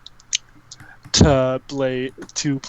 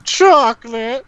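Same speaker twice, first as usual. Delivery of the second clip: in-character chocolate cry; 7 semitones higher, slower than usual. Acted. Character savoring chocolate, high drawn-out cry. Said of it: "That was in character".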